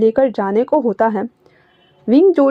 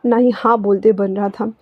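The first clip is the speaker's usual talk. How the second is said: ordinary delivery, nothing marked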